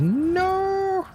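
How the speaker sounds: very high-pitched pleading laptop voice; whining laptop impression. Overloaded laptop protesting to its streamer, high whiny impression. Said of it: "That's a very accurate impression".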